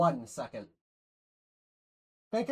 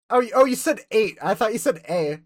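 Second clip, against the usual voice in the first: loud realisation; 9 dB louder than usual. Real, not acted. Realising the misheard word.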